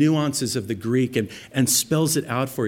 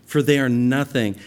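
preaching scripture; 2 semitones higher, 4 dB louder than usual. Acted. Reading Samuel's words.

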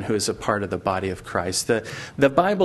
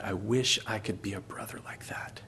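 very soft, lower near-whisper; hushed wishing kid; 4 semitones lower, 9 dB quieter than usual. Acted. Poor kid wishing to be such a brother, hushed and sincere. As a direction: breathe the wish out quietly.

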